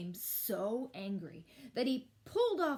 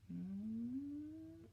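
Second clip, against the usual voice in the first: plain reading voice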